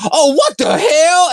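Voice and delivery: very high cartoon sidekick outcry; hammed-up high comic exclamation; Toad. Host predicting Toad's jokes in the film, high hammy cartoon voice. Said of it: "We're gonna be seeing Toad go".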